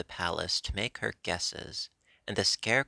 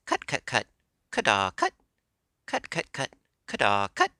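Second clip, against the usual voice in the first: higher and louder clucking hen cackle; hen's cackle; 4 semitones higher, 6 dB louder than usual. Acted. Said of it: "a shrill voice suddenly cried".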